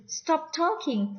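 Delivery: "Stop talking" high and drawn out. Shown as a demonstration. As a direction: lift "Stop talking" well up, slowly. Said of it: "agak meninggi gitu ya".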